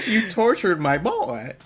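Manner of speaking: high indignant. Retold or imitated. Own indignant retort.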